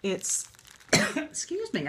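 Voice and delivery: throat tickle, clearing through words; frog in throat. Real, not acted. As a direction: talk past a scratchy throat. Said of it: "I've got a tickle".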